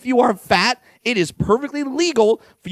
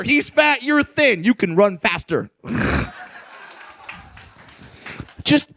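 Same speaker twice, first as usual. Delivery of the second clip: ordinary talk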